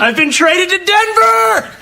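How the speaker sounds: high, slow, drawn-out cry; blurted announcement. Acted. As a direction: wail the news out to the room. Blurts out bad news at party.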